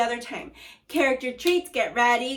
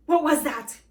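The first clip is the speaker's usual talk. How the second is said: mild mock cry; 4 semitones higher, 5 dB louder than usual. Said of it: "cried Mister, Missus Twit".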